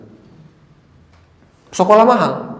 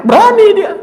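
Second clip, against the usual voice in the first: proud; 4 dB louder than usual. Real, not acted.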